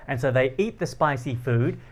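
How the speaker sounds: normal talking voice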